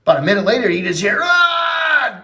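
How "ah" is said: scream. Retold or imitated. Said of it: "screaming so loud".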